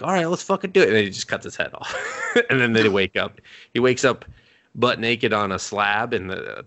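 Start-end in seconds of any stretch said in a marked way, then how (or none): 0.0-0.9 higher, pumped-up mock eagerness; hyped for the fight; eager. Demon accepting the duel, pumped and eager, higher.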